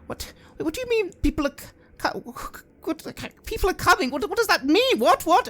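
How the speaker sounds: high, loud, stammering panic; a woman falling apart as her cult is exposed; panicked woman. Acted.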